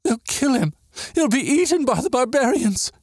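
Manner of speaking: high-pitched tearful wail; weeping. A narrator's reading. Girl dreading the boy's death, weeping. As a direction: wail it high, in acted tears. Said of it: "Katerina wept".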